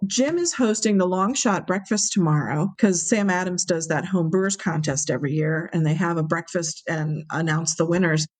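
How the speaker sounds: normal talking voice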